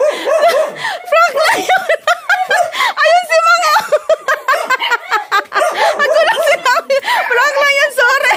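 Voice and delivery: high, loud laughing yell; laughing shout. Real, not acted. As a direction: shout it while cracking up. Prank revealed, laughing. (sumisigaw habang tumatawa)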